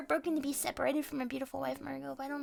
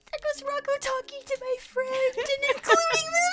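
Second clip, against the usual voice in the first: mock jealous whine; 13 semitones higher, 6 dB louder than usual. Jealous mock aside about Rocco.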